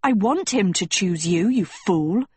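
plain reading voice

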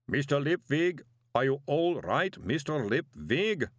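slow, booming golem calling out. A narrator's reading. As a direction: call out like a clay golem. Golem calls out.

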